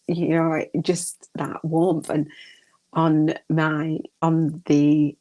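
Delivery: usual speaking voice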